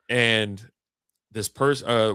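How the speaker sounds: usual speaking voice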